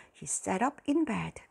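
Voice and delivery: plain reading voice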